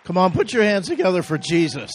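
loud, high, fast call for applause; rallying shout. Real, not acted. Pastor rallying congregation to applaud.

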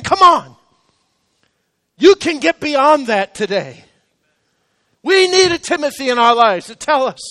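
loud, emphatic rallying call; impassioned preaching. Real, not acted. Pastor urging the hurting to get beyond it, full-force preaching. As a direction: push each phrase out loud and measured.

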